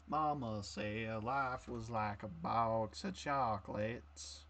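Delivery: slow, soft southern drawl; Forrest Gump impression. Shown as a demonstration. Showing a friend how Gump sounds, slow soft drawl. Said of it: "It needs to be more southern".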